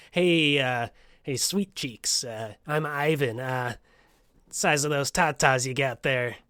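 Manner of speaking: lightly voiced slow, sleazy come-on; Ivan. Host imitating Ivan getting too personal, mock sleazy voice. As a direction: play a hesitant creep hitting on someone. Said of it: "his conversations would sometimes turn aggressively personal".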